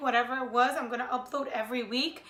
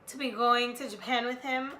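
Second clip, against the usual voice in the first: usual speaking voice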